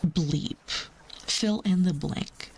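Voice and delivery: ordinary talk, nothing marked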